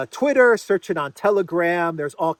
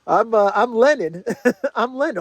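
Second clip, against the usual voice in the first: higher-pitched, laughing through a fake claim; laughing mock introduction; 6 semitones higher, 3 dB louder than usual. Vlogger mocks pranksters posing as Lenin, laughing. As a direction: pretend to be someone, cracking up.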